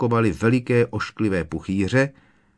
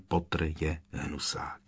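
whispered „Potter je hnusák.“, breathy and much softer; 5 semitones lower, 10 dB quieter than usual. A narrator's reading. Narrator hushing the slogan that surrounds Harry, whispered. Slogan glowing all around.

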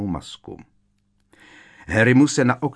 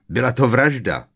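louder and sharp; barked accusation; 4 semitones higher, 6 dB louder than usual. A narrator's reading. Harry barks an accusation at Umbridge. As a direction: bark the word murder at her. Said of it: "vyštěkl Harry".

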